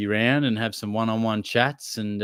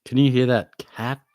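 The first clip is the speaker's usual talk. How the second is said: ordinary delivery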